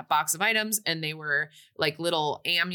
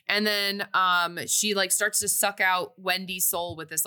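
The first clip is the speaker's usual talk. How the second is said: usual speaking voice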